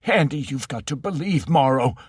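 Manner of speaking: put-on high, frantic old voice; near hysteria. Ocken begging Andy to give in, near-hysterical. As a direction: plead in rising terror. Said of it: "close to hysteria".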